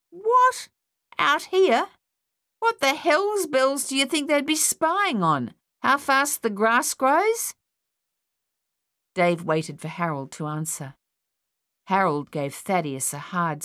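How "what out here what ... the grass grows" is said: high, loud scoffing outburst at the rumour; scoffing disbelief. Acted. Young man scoffing loudly at his mate's spy rumour. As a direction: scoff at the whole idea, voice shooting up. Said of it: "Thaddeus scoffed".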